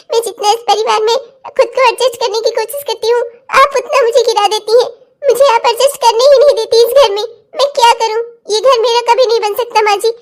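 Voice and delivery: halting, tearful lament; crying. Acted. Daughter-in-law telling mother-in-law she can't fit in, crying. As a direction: choke out the grievance between sobs. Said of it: "रुला दिया ना बेचारी बच्ची को".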